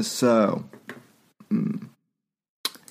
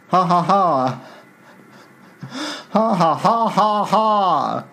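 evil cackle; 4 semitones higher than usual. Acted. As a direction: cackle like a cartoon villain. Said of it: "he cackled".

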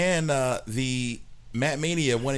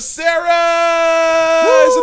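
ring announcer shout; 11 dB louder than usual. Acted. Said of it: "Ladies and gentlemen".

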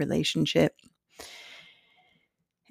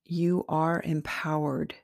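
guided meditation. Acted. Meditation host leading listeners into their heart center, slowly and calmly. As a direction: guide them gently inward, unhurried and spacious.

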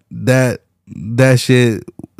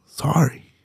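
a breathy, unvoiced little sorry; whispered sorry; 3 semitones higher, 6 dB quieter than usual. Real, not acted. Streamer apologising to a fan, whispered. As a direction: drop to a whisper, mock-apologetic.

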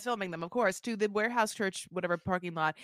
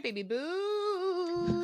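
drawn-out pet names; 9 semitones higher, 4 dB quieter, much slower than usual. Pretend. Hosts coo pet names at listeners.

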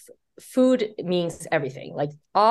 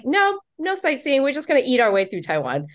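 playful refusal; 8 semitones higher than usual. Retold or imitated. Author replaying her answer to her husband, high and gleeful. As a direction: cheerfully shut down the sightseeing idea.

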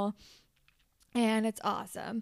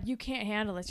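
ordinary talk

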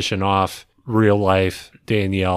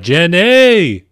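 mock yell of Jenny!, louder and higher; imitating a yell; 8 semitones higher, 8 dB louder than usual. Podcaster imitating Mike yelling for Jenny, mock yell. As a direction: call out Jenny! like Mike hollering for her. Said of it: "like, yelling for her".

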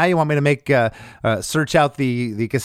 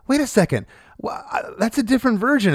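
higher, puzzled listener double-take; mock surprise; 9 semitones higher than usual. Startled fan hearing another version of the song, mock surprise. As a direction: play a listener caught off guard, pitched up. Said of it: "shock people and go".

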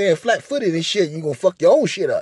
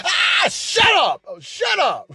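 shout; 11 semitones higher, slower than usual. Retold or imitated. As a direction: scream it out long. Shouting at her.

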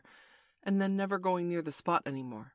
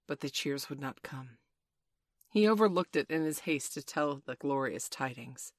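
ordinary delivery

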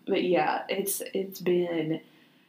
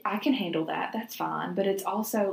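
ordinary talk, nothing marked

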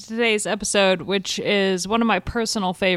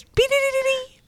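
news jingle beeps. Acted. News jingle to announce update.

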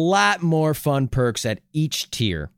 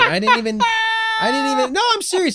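talking through sudden arm pain; wincing, high-pitched pained voice; hit my funny bone; 11 semitones higher, 4 dB louder than usual. Real, not acted. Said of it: "I hit my funny bone".

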